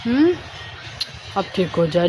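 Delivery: ordinary talk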